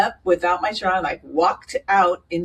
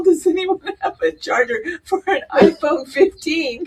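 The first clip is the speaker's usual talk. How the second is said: mock crying plea; 11 semitones higher, 5 dB louder than usual. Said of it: "I'm all".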